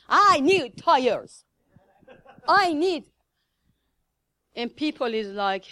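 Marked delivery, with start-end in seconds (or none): 0.1-3.0 slow, loud mock scream for tires. Preacher imitating the donkey car screaming for tires. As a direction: wail it out like a desperate beggar. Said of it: "start screaming".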